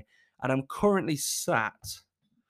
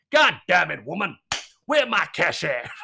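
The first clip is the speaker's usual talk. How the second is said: angry pimp; 5 dB louder than usual. Retold or imitated. Mimicking [name] as a pimp, mock angry. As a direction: growl it like a pimp after his money. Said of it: "like an angry pimp".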